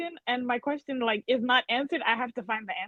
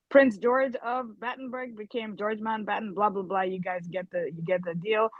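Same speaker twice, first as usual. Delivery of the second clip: ordinary delivery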